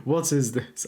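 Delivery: ordinary delivery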